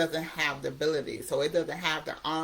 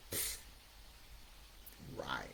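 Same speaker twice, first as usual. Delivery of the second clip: a dismissive pfft, then a whispered, much lower "right"; scoffing addictive voice; 19 dB quieter than usual. Retold or imitated. Addiction voice mocking the vow to quit, scoffing whisper. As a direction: breathe it out like you don't believe a word. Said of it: "your addictive voice is gonna say something like".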